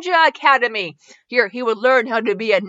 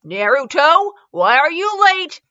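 mildly stern, raised-voice question; upset teacher; 4 semitones higher, 5 dB louder, slower than usual. A narrator's reading. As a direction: stretch out a louder, higher scolding. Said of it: "was upset he was late again".

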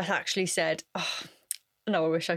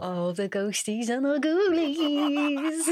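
slow, higher, a lightly spooky storyteller voice; mock spooky voice; 6 semitones higher, much slower than usual. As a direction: draw out the ghosties like a campfire tale. Playful spooky aside about paranormal.

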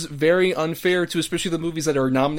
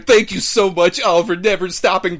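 gushing thanks; 4 semitones higher, 5 dB louder than usual. Acted. Mock acceptance speech thanks.

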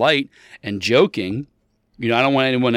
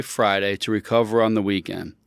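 ordinary talk, nothing marked

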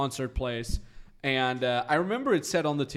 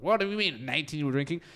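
mock American disbelief; 4 semitones higher, faster than usual.

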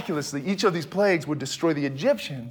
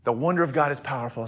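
fast, softer throwaway repeat of "The wonder of God is powerful"; quick recap; 6 semitones lower, 5 dB quieter, faster than usual. Shown as a demonstration. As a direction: toss it off quickly and lower. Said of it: "Okay, God's writing this story".

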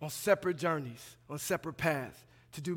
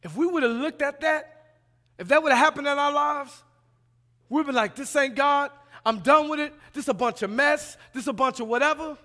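raised, higher preaching voice; fired-up preaching; 7 semitones higher, 8 dB louder than usual. Acted. Preacher acting out a fed-up believer to the church, raised voice. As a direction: preach it loud and exasperated.

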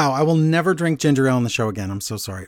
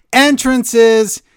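higher, louder excited shout; loud exclamation; 9 semitones higher, 7 dB louder than usual. Real, not acted. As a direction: belt out the word Entrances. Shouts repeated word from email.

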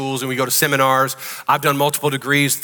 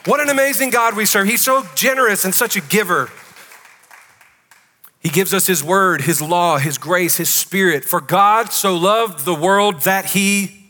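raised, ringing pulpit voice, pitched higher; full-force preaching; 5 semitones higher than usual. Acted. Pastor preaching to the congregation about God's generosity, full-force preaching. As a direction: preach it out big to the whole room.

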